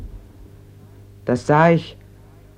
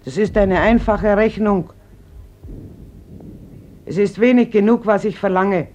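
louder, pained insistence; loud pleading; 5 semitones higher, 5 dB louder than usual. Acted. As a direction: she cries out her demand. Mother's emotional final demand.

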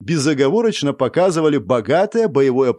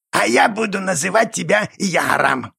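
creaky goblin voice; 5 semitones higher than usual. A narrator's reading. Goblin names the boy.

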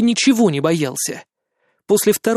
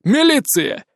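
shouted; 9 semitones higher, slower than usual. Acted. Policeman shouts identity.

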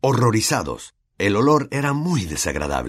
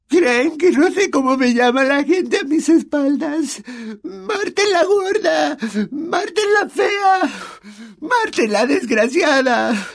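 very high, slow crying wail; sobbing; 4 dB louder, slower than usual. A narrator's reading. Myrtle listing her nicknames, sobbing. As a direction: sob out each cruel name. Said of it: "entre sollozos".

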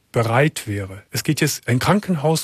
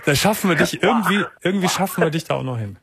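laughing while talking; 4 semitones higher than usual. Real, not acted. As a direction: let the laugh run through the words. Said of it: "Lachen ist nicht gut für die Galle".

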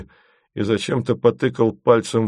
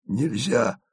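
softer sighing refusal; sighed; 5 dB quieter than usual. A narrator's reading. Asen refuses the detour with a sigh. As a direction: sigh it out resignedly. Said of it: "вздохнул Асен".

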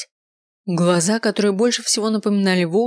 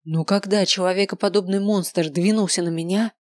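ordinary delivery, nothing marked

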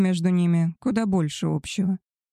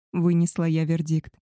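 plain reading voice

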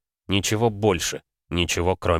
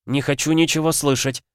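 anguished moan; 8 semitones higher than usual. Acted. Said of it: "простонала Алеидора".

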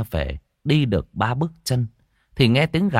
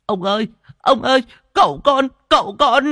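put-on high, loud child crying out in panic; a boy bursting in, shrieking for the master; panicked servant boy; 6 dB louder than usual. Servant boy runs in to tell master of disaster, panicked shriek. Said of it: "chạy vào lắp bắp".

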